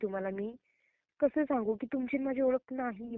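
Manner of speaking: ordinary talk, nothing marked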